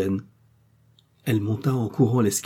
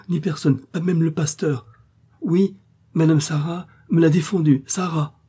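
voix aiguë et forte, suppliante; supplier, la vie en jeu; aubergiste paniqué; 6 semitones higher, 4 dB louder than usual. Acted. Frightened innkeeper blocks visitor. (l'aubergiste terrifié barre l'escalier, voix affolée)

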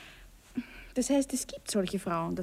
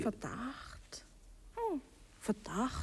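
hushed startled echo; 3 semitones higher, 9 dB quieter, much slower than usual. Acted. Wife startled by husband's question.

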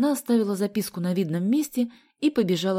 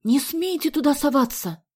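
plain reading voice

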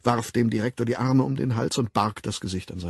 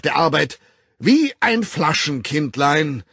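loud, high, formal public denunciation; a director condemning an employee before the staff; stern director's speech; 4 semitones higher, 8 dB louder than usual. Acted. Director denouncing Bernard to the assembled staff, loud and stern.

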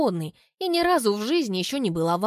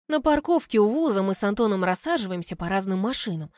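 plain reading voice

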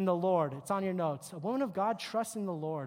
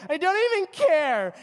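put-on higher, louder defiant teen girl; mock bratty teen; 6 semitones higher, 8 dB louder than usual. Girl snapping at her mother, loud mock defiance. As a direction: toss it off like a sulky teen. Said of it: "another term girls say".

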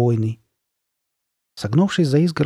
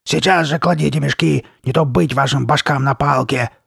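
loud, higher monster command; spiteful threat; 4 semitones higher, 5 dB louder than usual. A narrator's reading. Masked girl threatening the boys, spiteful. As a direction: order them fiercely at raised volume. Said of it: "злобным интонациям своего голоса".

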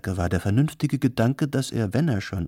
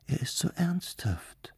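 high, soft whisper; 8 semitones higher, 6 dB quieter than usual. A narrator's reading. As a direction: whisper it secretly from behind a carpet. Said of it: "flüsterte das arabische Mädchen".

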